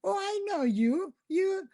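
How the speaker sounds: high, eager fan recognizing her; excited fan. Retold or imitated. Fan spotting Tracy's mom in public, high eager gush. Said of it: "Everywhere I go".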